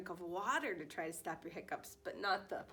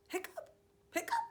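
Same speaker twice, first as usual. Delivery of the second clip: hiccups; faster than usual. Acted. Dog hiccuping in story.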